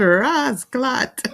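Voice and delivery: loud Jamaican patois exclamation. Retold or imitated. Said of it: "She said".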